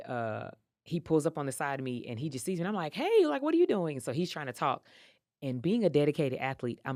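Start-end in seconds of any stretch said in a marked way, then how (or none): 2.9-4.0 high, louder startled hey; surprised greeting. Retold or imitated. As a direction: greet him, caught off guard. Surprised as he pulls up.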